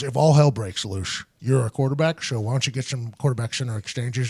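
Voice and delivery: low, soft coach voice; quiet low-pitched instruction; imitated coach. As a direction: drop low like a subdued coach.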